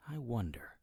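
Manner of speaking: hushed to himself; whispered musing. A narrator's reading. Man alone in a dark lab, whispering to himself. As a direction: breathe it as a private thought. Narrator thinks aloud.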